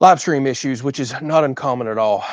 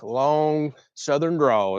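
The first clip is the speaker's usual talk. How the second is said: Southern drawl; 2 semitones higher, much slower than usual. Shown as a demonstration. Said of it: "as we call it".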